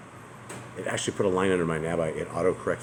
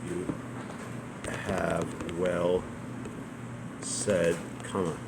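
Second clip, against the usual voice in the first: slow dictation; much slower than usual. Real, not acted. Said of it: "typing along, word by word".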